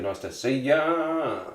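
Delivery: slow, stretching YA out long; drawn-out greeting. Real, not acted. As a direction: playfully lengthen the final YA.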